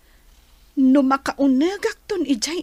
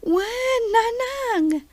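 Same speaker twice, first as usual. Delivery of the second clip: higher voice, close to crying; tearful yes; 9 semitones higher, much slower than usual. Acted. Daughter tearfully answering her mother. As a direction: answer through rising tears.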